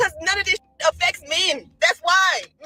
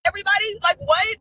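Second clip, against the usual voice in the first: usual speaking voice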